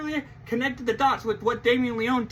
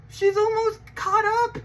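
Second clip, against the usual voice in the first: high excited announcement; 10 semitones higher than usual. Real, not acted. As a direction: hype up the news. Hyped announcement about the show.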